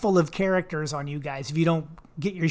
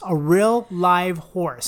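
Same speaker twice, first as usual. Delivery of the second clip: slow emphatic; 5 dB louder, much slower than usual. Real, not acted. Mock demand, slowed right down.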